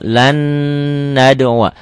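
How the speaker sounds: "Lan nad'uwa" with the n sustained; nasal idgham. Shown as a demonstration. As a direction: hum through the n of "Lan" before "nad'uwa". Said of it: "bunyi N-nya".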